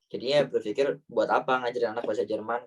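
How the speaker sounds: ordinary talk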